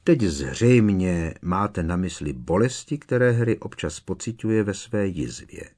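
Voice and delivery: plain reading voice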